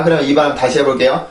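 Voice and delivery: ordinary talk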